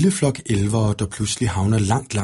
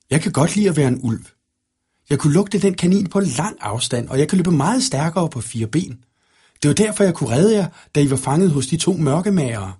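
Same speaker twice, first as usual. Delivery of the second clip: put-on higher, lively child-like boasting; eager young wolf boy; 6 semitones higher than usual. A narrator's reading. Enchanted brother defends being a wolf, eager and higher.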